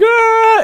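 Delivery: very high mock scream; piercing falsetto yell, imitating someone; imitated yelling. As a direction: scream it high and drawn out, in mimicry. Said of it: "like that person yelling".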